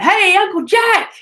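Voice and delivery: high, drawn-out call across the street; yelled greeting. Retold or imitated. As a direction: call out a retold hello from a car window. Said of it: "And I yelled out".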